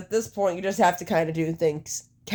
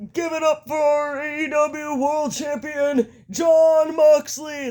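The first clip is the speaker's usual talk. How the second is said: Nick Jackson; 8 semitones higher, slower than usual. Acted. Fan mimics wrestler introducing the champion, hyped Nick Jackson impersonation. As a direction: announce the champion high, stretching out the name. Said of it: "That's my Nick Jackson impersonation".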